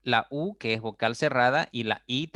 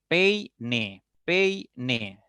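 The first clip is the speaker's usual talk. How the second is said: "peine" slowly as pei, ne; syllable by syllable. Shown as a demonstration. Teacher separating syllables, "peine" split in two. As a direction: hold pei in one beat, then ne in "peine". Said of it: "Diré".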